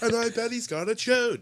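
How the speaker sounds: very high, restrained punchline voice; mock joke tag. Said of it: "That's the tag I needed".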